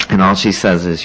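ordinary talk

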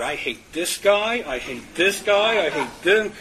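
imitating old resentment, slowed; resentful inner voice. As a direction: spit out the grudge, drawn out.

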